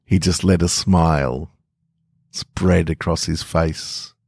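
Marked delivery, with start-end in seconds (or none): none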